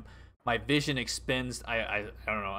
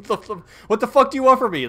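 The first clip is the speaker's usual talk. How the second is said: high loud exasperated outburst; exasperated yell; 11 semitones higher, 12 dB louder than usual. Retold or imitated. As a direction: throw up your hands and yell. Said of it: "I'm like".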